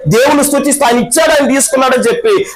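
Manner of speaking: high, ringing preacher's call over music; full-force preaching. Acted. Preacher rousing the congregation, full-force high preaching.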